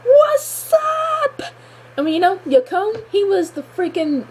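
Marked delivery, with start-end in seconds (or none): 0.1-1.4 high, hyped cry of triumph; hyped shout. Retold or imitated. Fan cheering Aang beating Yakone, high hyped cry. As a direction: celebrate the takedown like a sports win. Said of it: "and I was like".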